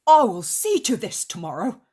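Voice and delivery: indignant resolve. Acted. Peter takes charge after freeing the master, indignant resolve. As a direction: declare it firmly, pitch swooping.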